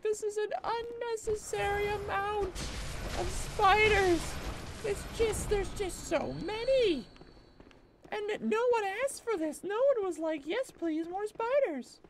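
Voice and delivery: very high mock wail; high-pitched comic protest, not shouted; exasperated comedy bit. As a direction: wail it in hammed-up despair. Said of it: "evolution or mutation of that bit".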